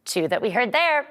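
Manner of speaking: normal talking voice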